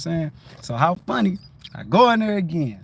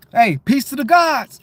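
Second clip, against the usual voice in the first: cheerful raised-voice send-off; upbeat parting call; 6 semitones higher, 6 dB louder than usual. Retold or imitated. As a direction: call it out higher and louder. Calls out parting greeting.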